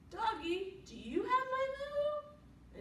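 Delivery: high, drawn-out pleading question; cow asking. A narrator's reading. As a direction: call out sweetly high, stretching the words. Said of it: "she asked the doggy".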